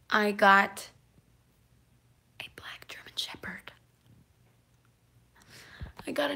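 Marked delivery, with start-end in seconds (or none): 2.4-3.7 whisper. Real, not acted. Secret reveal of new dog.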